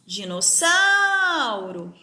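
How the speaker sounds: slow "Dinossauro", swelling on the third syllable; stressed syllable called out. Shown as a demonstration. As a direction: call out "Dinossauro", holding the SAU. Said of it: "Eu chamo a palavrinha como se".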